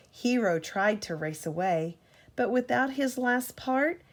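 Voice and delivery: ordinary delivery, nothing marked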